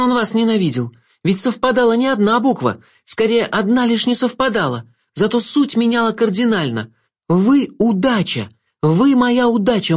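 put-on high, emphatic girl's voice; a girl driving her discovery home, pitched high; high and insistent; confident young heroine. A narrator's reading. Girl confronts the Jester.

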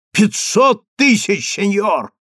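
high, stretched-out offer; desperate bidding. A narrator's reading. Rabbi raises the bribe to stop Daniil, desperate bidding. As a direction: raise the bid urgently, slow on the figure.

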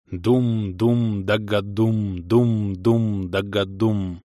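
slow, rhythmic drum chant; imitated drumbeat. A narrator's reading. Drums of mountain trolls roll for miles, slow rhythmic booming. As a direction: intone it slowly like rumbling drums. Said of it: "Рокочущие звуки барабанов".

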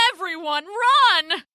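high cry building to the last word; panicked cry. A narrator's reading. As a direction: raise the alarm, pitched high. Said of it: "they cried".

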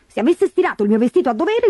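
high-pitched peevish grumble; petulant whine; whining stepsister. A narrator's reading. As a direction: complain like a spoilt, quarrelsome girl. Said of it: "si lagnava Berta".

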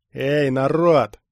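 slow, loud call to a crowd. A narrator's reading. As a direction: hail the whole crowd, drawing it out. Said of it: "повысил голос".

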